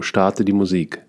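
usual speaking voice

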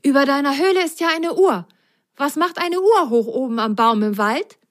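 high, fast, curious little voice; a small sock bursting with questions. Acted. Little sock asks the cuckoo about the clock, high and curious.

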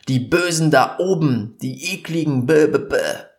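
mock the people who shout about the elite, bäh bäh; mocking rant. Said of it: "laut zu schreien und zu sagen".

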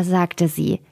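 usual speaking voice

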